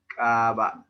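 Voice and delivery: CAVA with a loud, drawn-out first "a"; long vowel. Shown as a demonstration. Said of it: "Si es larga". ("Cava" con la primera a larga y fuerte)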